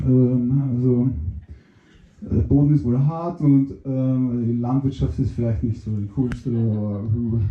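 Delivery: very low, dopey mushroom voice. Acted. Mushroom answers question.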